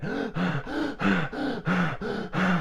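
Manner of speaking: high, loud rapid gasps; reliving the breathing with gasps; gasping breaths. Retold or imitated. Rapid deep breathing retold.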